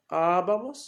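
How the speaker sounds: "abamos" slow, its first A stretched and strong; stress on first A. Shown as a demonstration. Teacher repeating the endings for the class, "abamos" stressed on the first A. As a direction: lean long on the opening A of "abamos". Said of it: "con acento sobre la primera A".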